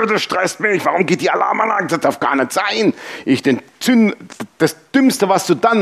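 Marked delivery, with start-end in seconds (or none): 0.0-2.9 stressed-out, whining exasperation, acted; mock panic. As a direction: play up being rattled by a blaring alarm. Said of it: "bin voll in Panik". (gespielte Panik, gestresst)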